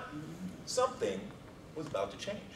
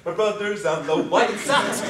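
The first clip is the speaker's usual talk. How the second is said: excited cheer; 5 semitones higher, 8 dB louder than usual. Retold or imitated. Repeated celebration chant.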